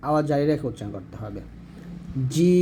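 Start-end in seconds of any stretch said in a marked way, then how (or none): 2.3-2.6 জিম with hard onset, held very long; firm letter demo. Shown as a demonstration. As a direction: hit the j of জিম firmly and stretch it. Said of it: "জিমটা শক্তভাবে". (শক্তভাবে টানা জিম)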